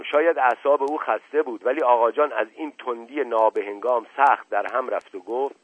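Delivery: plain reading voice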